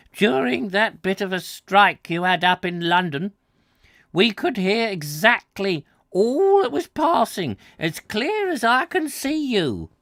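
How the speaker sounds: lightly voiced old farmer; old countryman. Old man telling the visitor about the wireless, lightly rustic.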